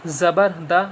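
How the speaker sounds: slow, syllable by syllable letter drill; slow spelling drill. Shown as a demonstration. Teacher drilling zabar letters for pupils, slow and deliberate. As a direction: spell each letter out slowly for children. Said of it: "ہجے بتا دیتا ہوں کیسے ہوگی".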